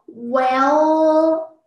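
long, loud "well" bending back up high; third tone. Shown as a demonstration. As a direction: stretch "well" into a rising end. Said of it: "But the third tone".

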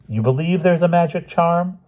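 high, disbelieving question; incredulous. Acted. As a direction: ask it in utter disbelief. Child incredulous at mother.

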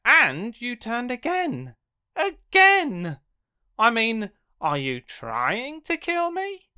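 high, loud outraged again; exasperated yell. Acted. Character yelling at the reader for turning again. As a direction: cry out in disbelief.

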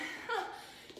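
short airy laugh; breathless laugh. Real, not acted. Laughs at hard exercise.

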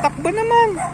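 very high squeaky dog-talk; squeaky praising a dog. Real, not acted. Cooing at dogs running.

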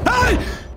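sudden high-pitched startled shriek; real shriek of fright; startled scream. Real, not acted. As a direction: scream from a jump scare. Jump scare in game.

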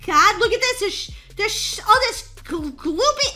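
stumbling over the words, excited and high; tongue-tied. Real, not acted. Player reacting to gross stuff on the floor, tongue-tied. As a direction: stammer out false starts, too worked up to talk. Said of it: "I can't even talk".

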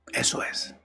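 breathy soft whisper. Acted. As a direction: whisper it close to the ear. Hushed intro, whispered.

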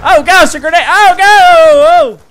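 high, loud startled shrieks; panicked yelp. Real, not acted. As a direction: shriek as the grenade lands. Grenade lands near him.

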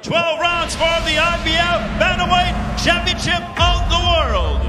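booming ring announcer. Acted. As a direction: announce it to the arena.

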